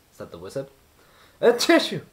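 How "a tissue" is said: high, loud sneezed "A tissue"; sneeze as words. A narrator's reading. Mister Sneeze sneezing in the snow, loud sneeze. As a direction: burst it out like a sneeze. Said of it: "sneezing again".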